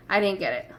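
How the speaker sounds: ordinary talk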